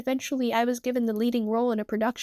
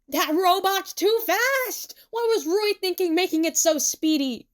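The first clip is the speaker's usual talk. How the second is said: high, louder outburst with stretched stresses; exasperated yelling; 9 semitones higher, 4 dB louder than usual. A narrator's reading. Tsukasa chasing a too-fast robot, exasperated yelling. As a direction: complain at high pitch mid-chase.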